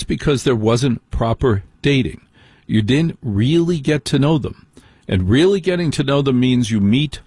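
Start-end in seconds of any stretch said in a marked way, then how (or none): none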